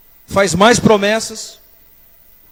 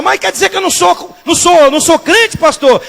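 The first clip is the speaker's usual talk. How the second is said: high, loud protest in imitation; raised-pitch mock indignation; mocked indignant member; 9 semitones higher, 6 dB louder than usual. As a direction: mimic an affronted churchgoer objecting to the pastor. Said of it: "Aí eles falam".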